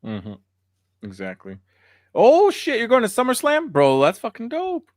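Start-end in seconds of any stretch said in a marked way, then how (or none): 2.1-4.9 high, loud excited outburst. Real, not acted. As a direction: burst out in excitement. Excited reaction to news.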